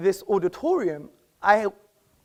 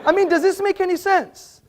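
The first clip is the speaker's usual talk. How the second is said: high and louder, laughing through the words; laughing mockery; 8 semitones higher, 4 dB louder than usual. Real, not acted. Debater laughing at his own nothing-for-lunch joke, laughing. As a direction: laugh at the absurd idea of eating nothing.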